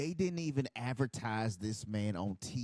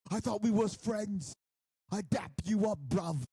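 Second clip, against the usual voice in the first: imitating a London lad, higher and louder; mock British accent; 7 semitones higher, 3 dB louder than usual. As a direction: put on a sing-song London voice. Voicing Will Ospreay's plea.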